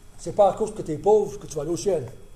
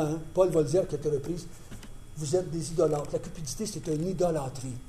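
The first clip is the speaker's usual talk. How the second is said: normal talking voice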